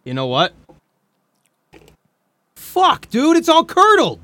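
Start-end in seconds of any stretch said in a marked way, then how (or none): 2.6-4.2 high, loud disgusted shout; shocked yelp. Real, not acted. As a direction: yelp at the spoiled drink. Discovers curdled kombucha.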